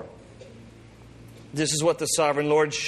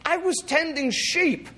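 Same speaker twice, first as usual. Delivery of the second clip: much higher, whiny reluctant farmer; shepherd prophet complaining to the priest, much higher; exasperated Amos; 12 semitones higher than usual. Acted. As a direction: plead to be left alone, fed up. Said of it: "And Amos says".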